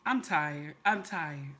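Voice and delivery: low, quiet, fed-up aside; exasperated. Real, not acted. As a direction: drop low and give up. Realizes sympathy was misplaced.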